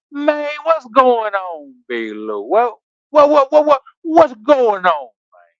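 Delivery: slow, stretched Memphis imitation of Cortez; lightly played slow Memphis accent. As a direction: draw out the Memphis greeting slowly, repeating what. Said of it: "He talk like this. He from Memphis".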